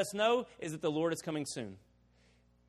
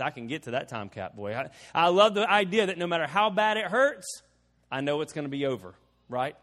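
ordinary talk, nothing marked

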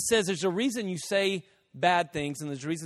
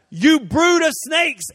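slow, loud, high preacher's yell; Jesus yelling; 9 semitones higher, 8 dB louder, slower than usual. Retold or imitated. Preacher voicing Jesus rebuking people, loud slow yell. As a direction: bellow it at the crowd, drawn out. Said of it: "which means he yelled it at people".

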